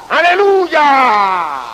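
long drawn-out, very slow shout of aleluya; heavenly shout. A narrator's reading. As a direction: stretch "¡Aleluya!" like a cry from heaven. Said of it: "que grita".

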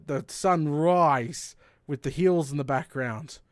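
ordinary talk, nothing marked

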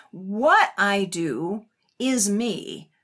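"What I do is me" slow and weighted on "What"; deliberate, weighty reading; slow emphatic. Shown as a demonstration. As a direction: let each word land. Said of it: "important for expressing a key meaning".

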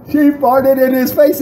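incredulous high-pitched restatement; high, clear repeat. Retold or imitated. Clear repeat after bouncer's "What?.

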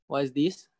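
normal talking voice

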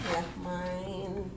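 normal talking voice

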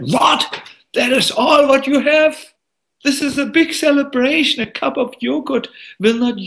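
high-pitched, scornful outburst; angry teacher. Acted. Teacher scolds boy over his cup of yogurt, acted anger. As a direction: scold in a high, indignant voice, picking up speed. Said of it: "out of anger".

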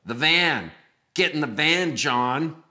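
loud, high mock-psycho order; insistent creepy kidnapper. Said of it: "in that type of voice".

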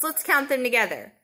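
ordinary talk

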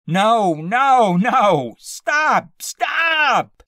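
very high, loud frantic screams; terrified shrieking. A narrator's reading. Pinned man begging his attacker, shrieking in terror. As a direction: shriek for it to stop. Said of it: "he had to shriek again".

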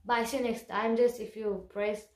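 usual speaking voice

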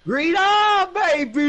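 high loud celebration; excited yell. Real, not acted. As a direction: cheer it, thrilled. Celebrating a perfect shot.

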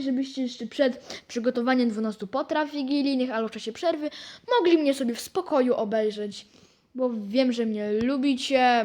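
usual speaking voice

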